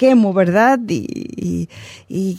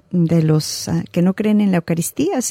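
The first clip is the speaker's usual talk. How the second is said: ordinary delivery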